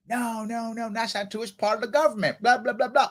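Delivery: high, overlapping-style chorus of denial; mock protest. Classmates insisting to him that he's wrong, mock protest. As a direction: mimic people insisting, trailing into blahs.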